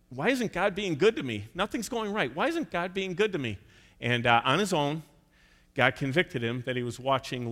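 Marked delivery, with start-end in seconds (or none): 0.1-3.6 whiny, higher complaint; complaining. Retold or imitated. Pastor imitating a churchgoer's whiny complaint. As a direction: mimic a man grumbling at God. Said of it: "he's complaining".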